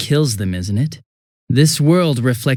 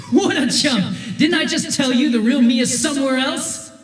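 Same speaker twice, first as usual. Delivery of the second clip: mocking taunt; 9 semitones higher than usual. Villain's double taunting the team, higher mocking voice. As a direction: sneer at how easily they fell for it.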